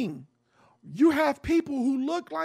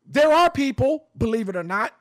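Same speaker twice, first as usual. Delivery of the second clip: usual speaking voice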